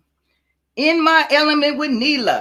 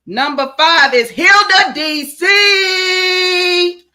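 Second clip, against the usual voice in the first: loud, high, drawn-out reveal; triumphant game-show style reveal; winner announcement; 8 semitones higher, 5 dB louder, slower than usual. Real, not acted. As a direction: shout the winner's name, stretching it out.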